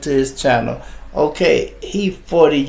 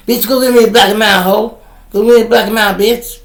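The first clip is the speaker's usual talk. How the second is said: put-on rude young guy barking orders; 8 semitones higher, 6 dB louder, faster than usual. Young guys bossing women around.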